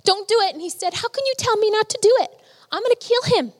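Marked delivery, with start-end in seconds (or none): 0.0-0.5 imitating a wife's high-pitched plea; retold begging. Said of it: "his wife was begging him". 0.9-3.5 high-pitched, worked-up imitation of a father; retold angry outburst. Retold father's furious argument with wife.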